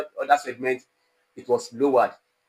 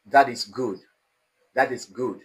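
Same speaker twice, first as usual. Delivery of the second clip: "That is good" settling down on 'good'; falling tone. Shown as a demonstration. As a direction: state it, dropping the pitch on 'good'. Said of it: "will be in the falling tone".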